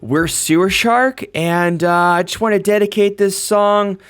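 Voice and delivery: loud, high-pitched stage announcement; frontman addressing crowd. Acted. Bearded guitarist dedicates songs to Marceline at a show, loud stage address. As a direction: project it like a singer at the mic. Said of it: "and he goes".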